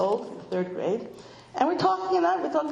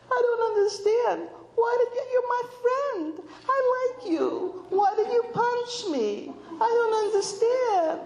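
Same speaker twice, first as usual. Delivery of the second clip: high, whiny mock crying; imitated crying child; 12 semitones higher than usual. Six-year-old on the phone to his friend, mock tearful. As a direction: play a hurt little boy wailing, far higher in pitch. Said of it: "and he's crying. And in between the crying".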